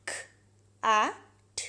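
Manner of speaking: ख, ए, ठ as separate "k", short "a", "t"; sounded out. Shown as a demonstration. Teacher spelling out cat by sound, ख, ए, ठ each isolated. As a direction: break cat into ख, ए, ठ with long gaps. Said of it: "short sound में words".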